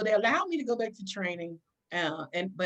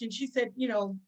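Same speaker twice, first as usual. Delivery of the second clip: ordinary talk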